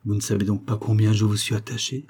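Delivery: usual speaking voice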